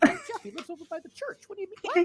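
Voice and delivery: very high, mock singsong quote; teasing high-pitched mimicry; mocking imitation. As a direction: parrot the line back in a silly high voice. Brother mocking the line.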